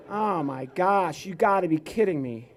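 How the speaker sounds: usual speaking voice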